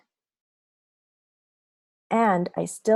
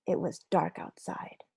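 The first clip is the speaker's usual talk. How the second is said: whisper; 6 dB quieter than usual. Acted. Waking in the dark.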